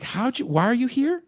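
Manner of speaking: high, puzzled questioning voice; lightly voiced label man asking; a label exec questioning the band; curious exec. Label exec quoted in story.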